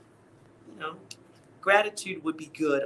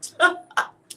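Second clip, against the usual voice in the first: breathless laugh; 5 dB louder than usual. Real, not acted. Laughs at own stumbling.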